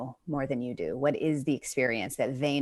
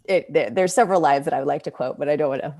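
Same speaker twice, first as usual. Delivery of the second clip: normal talking voice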